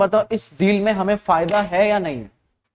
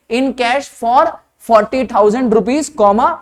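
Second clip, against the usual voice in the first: slow, emphatic read-out with the comma spoken; deliberate dictation of exam text; careful reading; 2 semitones higher, much slower than usual. Shown as a demonstration. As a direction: stretch each chunk, pausing after "for". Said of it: "ध्यान से पढ़ो".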